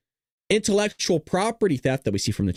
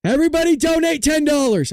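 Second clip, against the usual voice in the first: high and louder, joking command; mock demand; 10 semitones higher, 5 dB louder than usual. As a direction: boss the chat around for fun.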